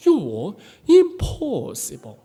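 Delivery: slow, drawn-out you impossible in a foreign teacher voice; teacher's verdict. Retold or imitated. Frustrated English teacher telling Michael he is impossible. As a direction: pronounce it slowly, as a final judgment. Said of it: "그러더라고".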